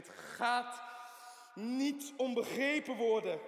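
forceful repeat. Acted. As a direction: hammer the point home the second time. Patient repeats his point twice. (harder herhaald, langzaam en nadrukkelijk)

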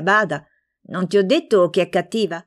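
ordinary delivery, nothing marked

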